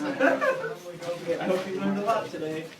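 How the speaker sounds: laughing. Acted. Mock lesson sign-off, laughing.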